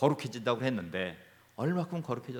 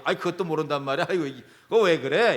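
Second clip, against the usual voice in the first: louder, fast exasperated scolding; retold irritated outburst; 7 dB louder than usual. Pastor recalling snapping at family, irritated and louder. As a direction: snap at them like you've lost patience. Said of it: "언성이 높으니 나도 모르게".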